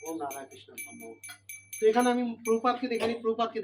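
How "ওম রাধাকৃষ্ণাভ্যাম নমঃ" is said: softer, steady mantra chant; a priest reciting offering mantras, softly; mantra recitation. Shown as a demonstration. Demonstrates offering mantras in sequence.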